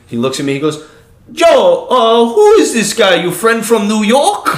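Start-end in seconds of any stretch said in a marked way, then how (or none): 1.3-4.5 loud, high-pitched Russian-accented impression. Russian buddy asking who the stranger is, loud imitated Russian accent. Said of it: "my buddy Jan".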